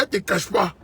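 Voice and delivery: ordinary talk, nothing marked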